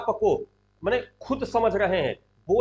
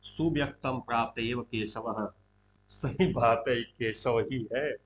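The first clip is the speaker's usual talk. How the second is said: low, hushed aside to oneself; Duryodhana thinking aloud; 7 semitones lower, 5 dB quieter than usual. Acted. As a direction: murmur the realisation slowly, privately. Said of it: "अपने आप से कह रहा है मन में".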